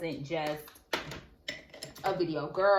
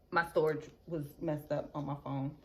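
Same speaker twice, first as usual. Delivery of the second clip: ordinary talk, nothing marked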